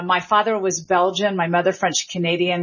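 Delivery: ordinary talk, nothing marked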